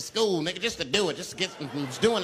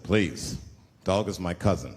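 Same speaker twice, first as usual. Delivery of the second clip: lower, a touch offended; dignified elder; 9 semitones lower than usual. Retold or imitated. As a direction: correct him gravely in a low voice. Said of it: "He said".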